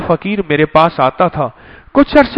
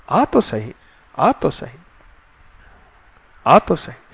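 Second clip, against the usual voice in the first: softer coaxing call, falling away; gentle call; 3 semitones lower, 6 dB quieter than usual. Acted. God gently urging his servant to come. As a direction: coax softly, like calling someone close.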